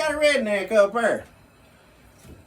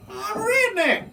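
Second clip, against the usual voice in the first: lightly voiced braggart, pitched high; high, mock-proud claim; mock bragging truck owner; 10 semitones higher, slower than usual. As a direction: proudly announce it in a higher voice.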